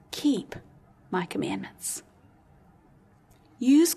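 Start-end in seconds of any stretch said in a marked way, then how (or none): 0.1-2.0 hushed words of Jesus. Acted. Preacher quoting Jesus to his congregation, low and hushed. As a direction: drop low and soft on "my commandments".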